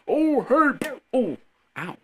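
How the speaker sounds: high chirpy greeting that breaks into a hurt yelp; hammed-up greeting then ow; mock greeting cut short by pain. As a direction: greet cheerfully, then get hit. Imagined person hit by batarang.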